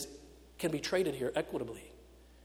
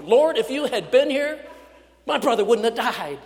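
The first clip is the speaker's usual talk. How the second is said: much higher and louder, hurled like blame; furious accusation; 7 semitones higher, 10 dB louder than usual. Acted. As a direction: throw it in his face, full of anguish. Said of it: "in the same tone that she busts through".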